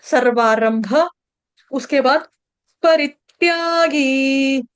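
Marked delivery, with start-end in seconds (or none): 2.8-4.6 परित्यागी slowly, with a short री and a long गी. Shown as a demonstration. Teacher modelling vowel lengths for students. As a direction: stretch out परित्यागी carefully. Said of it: "री ह्रस्व है".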